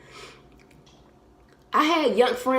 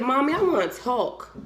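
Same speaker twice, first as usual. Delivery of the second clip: lightly voiced little son. Five-year-old son asking his mommy to talk, lightly voiced child.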